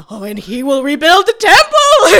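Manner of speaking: loud, high mock ranting monologue; hammed-up loud monologue; monologuing. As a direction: bellow it like a doomsday prophet.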